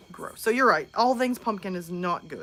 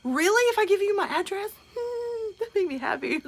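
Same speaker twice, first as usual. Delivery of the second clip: high, thrilled and giggly; overjoyed high giggling reaction; delighted surprise; 11 semitones higher than usual. Real, not acted. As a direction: squeal with real delight at the offer. Delighted by viewer's offer.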